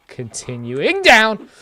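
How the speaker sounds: high, slow, loud mock dramatic call; scared horror game streamer. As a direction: announce the descent theatrically.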